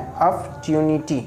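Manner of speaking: अपॉर्चुनिटी pronounced "ap-tyu-ni-ti", without any r; recommended pronunciation. Shown as a demonstration. Teacher stating the preferred pronunciation, अपॉर्चुनिटी as "ap-tyuniti". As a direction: give अपॉर्चुनिटी a "tyu" after the p. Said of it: "इसका सही उच्चारण है".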